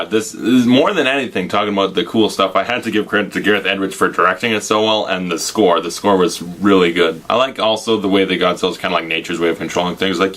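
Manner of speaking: ordinary talk, nothing marked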